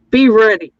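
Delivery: loud punchy "be ready"; emphatic call. Acted. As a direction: punch it out like a slogan. Hosts sign off with a call.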